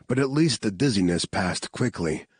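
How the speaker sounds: ordinary delivery, nothing marked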